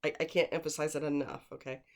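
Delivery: ordinary talk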